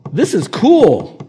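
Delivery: high, loud, slow, drawn-out; amazed joy; delighted. Retold or imitated. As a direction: savour the surprise of being healed. Voicing a healed leper.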